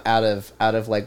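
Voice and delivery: ordinary talk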